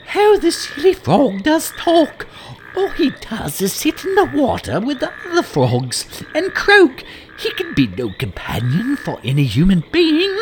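very high girlish voice, mocking; scornful princess. Princess privately scorns the frog, very high mocking voice. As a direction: sneer at the silly frog like a spoiled girl.

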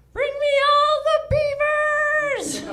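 high, slow, loud decree laughing; slow booming command, cracking into a laugh; commanding shout. Pretend. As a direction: bellow it like a mad prophet. Noah commanding the animals.

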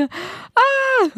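sudden high-pitched shriek of joy; excited scream. Real, not acted. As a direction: let out a burst of excited squeal. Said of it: "sorry for screaming in your ears".

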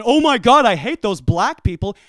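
loud, hammed-up exclamation of hate; exaggerated acted rant; mock outburst. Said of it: "This isn't me looking at him going".

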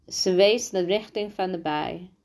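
ordinary delivery, nothing marked

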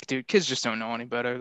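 ordinary talk

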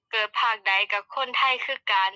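the phrase in native Isan dialect; Isan accent. Shown as a demonstration. Guest demonstrates the Isan phrase to the host, in native Isan accent. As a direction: show how Isan people really say it. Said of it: "สำเนียงอีสานก็จะประมาณว่า".

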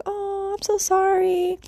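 high, slow, drawn-out mock sympathy; sympathetic mother. Mom consoling her sick child, mock-sympathetic.

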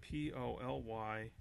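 slow, soft letter-by-letter spelling. Real, not acted. Host spelling a word for co-host, slow and deliberate. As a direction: spell it out slowly and quietly, pausing between letters. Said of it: "Can you spell it out for me".

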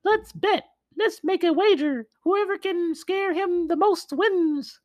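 very high, eerie ghost voice. Acted. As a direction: squeak it out like a spooky ghost. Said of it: "The fourth ghost said".

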